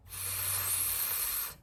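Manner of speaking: long, soft "f" on breath alone; stretched-out f. Shown as a demonstration. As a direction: lip lightly bitten, hold "f" without voice. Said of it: "where I bite my lip a little bit".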